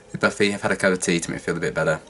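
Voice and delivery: ordinary delivery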